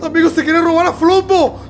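normal talking voice

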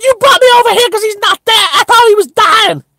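fast, very high-pitched squawk; outraged protest. Retold or imitated. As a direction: protest in shrieking mock disbelief. Retold outraged quarrel on learning news.

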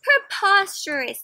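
high, loud, slow scorn; teacher rejecting excuse, scornful. Acted. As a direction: stretch it out with disdain. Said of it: "said Miss Gernsblot".

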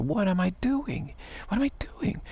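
high, soft, incredulous self-questioning; self-reproach. Retold or imitated. Man catching himself copying someone's accent, high incredulous self-questioning. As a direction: climb into a high, light pitch as you catch yourself.

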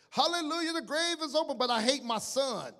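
high, loud jubilant church shout; imitating a congregation's loud hallelujah cry; mock praise shout. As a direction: shout it like an exultant worshipper. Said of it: "we're gonna shout".

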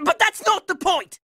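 normal talking voice

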